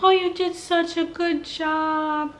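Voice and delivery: high, slow, sugary doctor voice; mock her fake sweetness; cheerful doctor. Mother mimics the doctor praising her after birth, sugary and high. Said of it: "here comes this lady".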